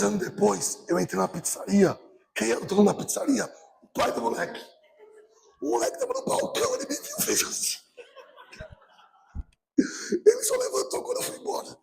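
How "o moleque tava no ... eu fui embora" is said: high, soft, laughing telling; amused hush. Real, not acted. Pastor telling the congregation how the boy hid, softly amused. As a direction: hold back a laugh as you tell it. Comic story of meeting the boy.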